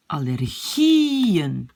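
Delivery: "allergieën" very slow, long swelling GIE, falling -en; accent sur le I. Shown as a demonstration. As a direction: stretch GIE in "allergieën" up and over. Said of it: "l'accent est sur le I". (« allergieën » très lent, GIE allongé et accentué)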